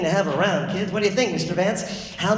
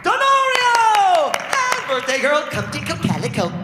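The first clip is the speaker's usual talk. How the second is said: much higher, loud game-show announcer; host calling out the players; 13 semitones higher, 5 dB louder than usual. Acted. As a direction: hype each name like a ring announcer. Announcing actors.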